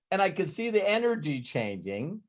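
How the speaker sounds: usual speaking voice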